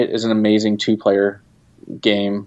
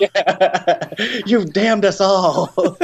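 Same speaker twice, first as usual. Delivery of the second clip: high, slow comic lament; mock despair; 10 semitones higher, much slower than usual. Team blaming boss for the wrong name, mock despair. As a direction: wail it like hammed-up doom. Playing his dismayed team, joking.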